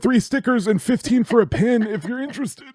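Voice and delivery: high, gushing merch pitch; fake cheerful. Comic selling merch to grieving fans, awkwardly gushing. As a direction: gush sweetly, then push the sale.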